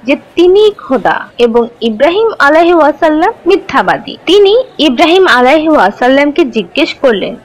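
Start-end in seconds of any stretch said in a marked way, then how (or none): none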